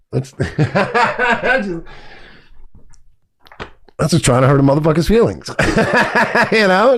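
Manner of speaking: laughing at the start of the line. Real, not acted. Laughing as he repeats the line on request. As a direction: let a laugh break in. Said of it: "one more time".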